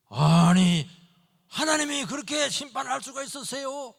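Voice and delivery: loud, high outraged protest; Abraham challenging God out loud. Retold or imitated. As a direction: cry out against God's verdict.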